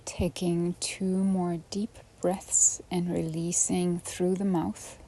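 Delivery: soft, unhurried yoga nidra guidance with long pauses; hushed relaxation-class voice; guided meditation. Acted. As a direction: ease the listener gently down into rest.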